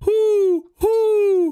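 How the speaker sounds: high, drawn-out owl hoot; two long hollow hoots. Pretend. Disguised prankster fools his friend, high owl hooting. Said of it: "make some owl sounds".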